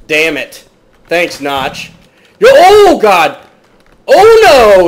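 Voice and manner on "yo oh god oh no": high, very loud cries of alarm; alarmed shouting. Real, not acted. Gamer ambushed by a zombie, alarmed shouting. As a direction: yell out as if jumped.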